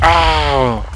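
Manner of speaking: alarmed loud scream. Real, not acted. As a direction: scream at the attack. Archer attacks. (grito de susto)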